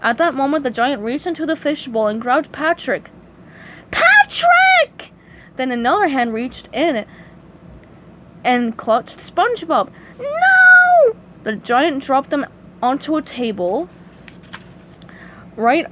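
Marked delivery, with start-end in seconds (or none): 3.9-5.1 piercing high cry of a name; loud high wail; panicked scream. A narrator's reading. Friend grabbed by the giant. 10.2-11.2 long, piercing high "no"; loud cartoon wail; terrified scream. A narrator's reading. Clutched by the giant.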